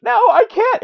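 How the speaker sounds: high-pitched cartoon protest; mock dismay. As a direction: squeal a hammed-up refusal. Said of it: "he's like".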